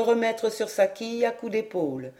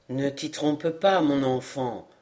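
put-on low, slow old man; serene; 7 semitones lower, slower than usual. A narrator's reading. Dying elder corrects the young man's hope, serene. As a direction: answer gently, voice dropped. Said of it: "répondit Chariclès avec sérénité".

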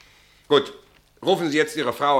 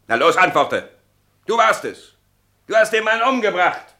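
louder, pushing harder with each line; angry demand; 6 semitones higher, 5 dB louder than usual. Acted. Captain to the silent suspect, angry and loud. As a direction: bark the accusation at him.

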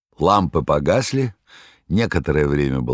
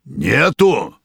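menacing bass roar; 6 semitones higher, 5 dB louder than usual. A narrator's reading. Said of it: "грозным басом взревел повар".

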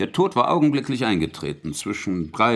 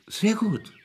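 higher, sugary mock praise; higher, falsely delighted approval; 10 semitones higher, slower than usual. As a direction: sound pleased, a cat with a mouse. Interrogator feigns delight.

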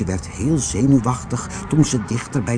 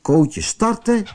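plain reading voice

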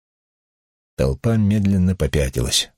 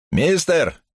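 high-pitched acted holler; heckler's yell; 12 semitones higher than usual. A narrator's reading. Another onlooker hails the man, high acted yell. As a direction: yell to get a stranger's attention. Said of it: "заорал ещё кто-то".

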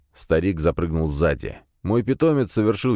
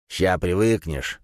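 smirking; slower than usual. Acted. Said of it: "хмыкнул старик".

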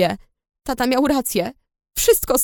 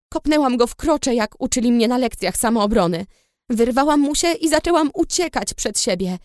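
ordinary delivery

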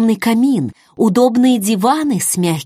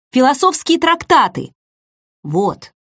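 higher-pitched huffy addition, dropping at the end; offended grumble; 6 semitones higher than usual. A narrator's reading. Younger man backing up his boast about Kitty, offended. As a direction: add it as a sulky clincher. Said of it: "обиженно пробубнил Шейнер".

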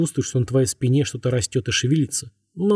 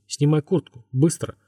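ordinary delivery

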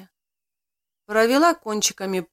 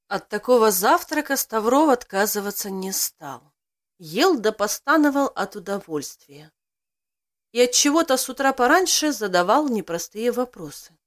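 plain reading voice

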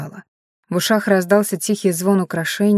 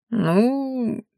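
drawn out; 4 semitones higher, 3 dB quieter than usual. Acted. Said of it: "протянул Шедай".

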